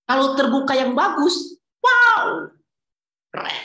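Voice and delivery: loud, high, gleeful "wow"; mock delight. Preacher joking about God being open in a good way, loud and gleeful. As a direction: burst out in a hammed-up cheer.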